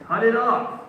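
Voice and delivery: loud, high, forceful order; vehement command. Acted. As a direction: burst out with it as an urgent command. Preacher acts Jesus's vehement command.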